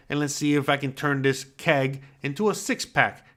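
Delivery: ordinary talk, nothing marked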